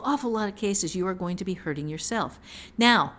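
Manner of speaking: normal talking voice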